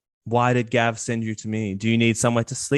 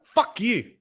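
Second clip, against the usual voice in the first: much higher, indignant dwarf scoffing; scornful dwarf; 8 semitones higher, faster than usual. Acted. Dwarf mocking the player's demon-slaying boast, high and indignant. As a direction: scoff at the boast then curse.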